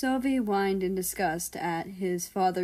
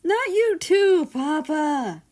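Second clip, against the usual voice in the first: much higher, louder slow whine; exasperated groan; 10 semitones higher, 10 dB louder, slower than usual. A narrator's reading. Son embarrassed by his father, loud exasperated whine. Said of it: "Germany groaned".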